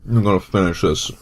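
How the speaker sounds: fast, loud, gritted-through resolve; hurried exasperated pep talk; rushed determination. Real, not acted. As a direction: rattle it off to get it over with. Pushing through annoyed.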